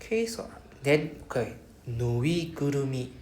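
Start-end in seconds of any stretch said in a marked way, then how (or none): 1.9-3.1 nuigurumi said slowly, each part clear; slow, broken up. Shown as a demonstration. Teacher models a slow nuigurumi. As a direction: take Nuigurumi slowly, no rushing. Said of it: "Break it apart".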